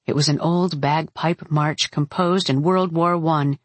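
ordinary delivery

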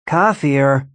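usual speaking voice

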